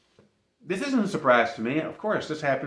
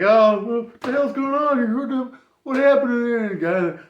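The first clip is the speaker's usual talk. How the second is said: custodian; 6 semitones higher, 5 dB louder than usual. Retold or imitated. Custodian voiced discovering the flood.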